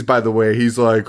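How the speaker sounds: ordinary talk, nothing marked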